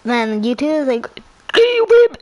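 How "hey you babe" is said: high, loud mock voice. Imitating YouTube to a friend, loud mock come-on. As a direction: call it out loud and high, hamming it up.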